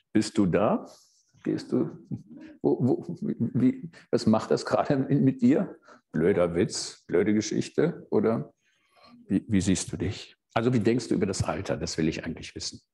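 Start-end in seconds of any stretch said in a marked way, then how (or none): none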